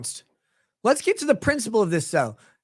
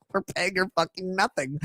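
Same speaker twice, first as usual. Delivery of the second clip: mock smug executives; 4 semitones lower, 5 dB quieter than usual. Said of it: "They're like".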